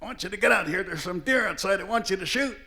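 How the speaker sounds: high, loud wake-up yell as an uncle; imitated yelling. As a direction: holler like an uncle rousing a sleeping kid. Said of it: "he's yelling at me".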